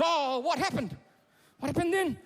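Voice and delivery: high-pitched, urgent dad questioning; prompting father. Acted. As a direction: press for the story as an anxious father. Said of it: "And Mike goes".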